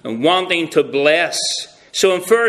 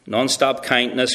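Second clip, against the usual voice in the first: normal talking voice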